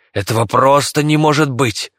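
emphatic cry of refusal to believe; outraged disbelief. A narrator's reading. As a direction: burst out in disbelief. Disbelief rises to outcry.

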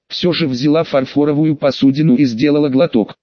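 usual speaking voice

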